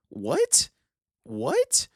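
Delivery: baffled high-pitched what; incredulous what. Acted. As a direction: squeak it out in disbelief. Mock disbelief at complainers.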